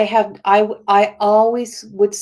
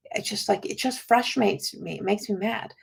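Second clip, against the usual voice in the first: much softer, almost under the breath; quiet frustrated quote; 10 dB quieter than usual. Retold or imitated. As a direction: mutter the old complaint quietly to yourself. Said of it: "I would always say".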